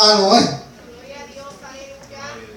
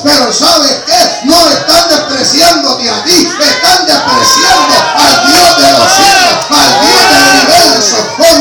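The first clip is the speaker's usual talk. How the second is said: loud, rising fervent preaching; fiery pulpit shout; preaching at full force; 4 dB louder than usual. Real, not acted. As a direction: shout it out to the church like a revival preacher. Pastor preaching at climax.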